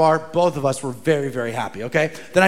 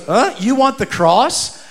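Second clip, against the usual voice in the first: slow, higher-pitched mock disbelief; puzzled question; 5 semitones higher, slower than usual. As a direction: imitate a baffled shopkeeper double-checking. Shopkeeper's puzzled question acted out.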